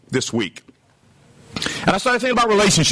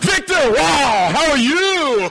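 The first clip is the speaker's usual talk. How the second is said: very high, gushing mock greeting; delighted greeting; 9 semitones higher, slower than usual. Snubbed preacher hailing Victor, hammed-up delight. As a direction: greet a rescuer with exaggerated relief, pitched way up.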